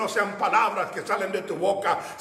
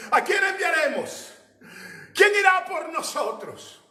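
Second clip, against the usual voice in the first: high, ringing call in a preacher's voice; proclaimed divine call; 7 semitones higher than usual. Acted. Preacher voices God asking who will go, high proclamation. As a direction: call it out high, falling at the end.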